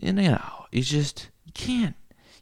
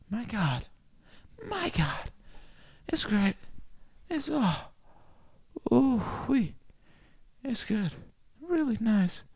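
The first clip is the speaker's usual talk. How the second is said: much higher, swooning ecstasy; blissful rapture; 11 semitones higher than usual. Pretend. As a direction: moan with delight at the bed. Ecstatic over waterbed.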